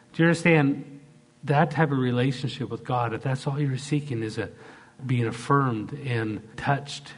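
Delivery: ordinary talk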